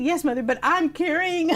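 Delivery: high, laughing reply, slow at the end; laughing protest. Retold or imitated. Retorting to mother, laughing.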